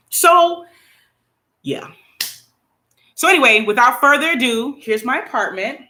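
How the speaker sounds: usual speaking voice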